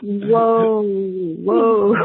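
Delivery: loud, drawn-out amazed whoa with a laugh. Real, not acted. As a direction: let the amazement burst out. Astonished laughing reaction.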